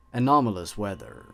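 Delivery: ordinary delivery, nothing marked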